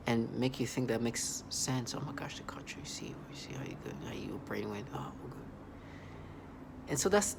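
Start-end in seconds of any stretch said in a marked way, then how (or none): 2.0-5.4 soft, hushed sarcastic taunt; mock whisper of a taunting voice. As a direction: whisper it like a sneering voice.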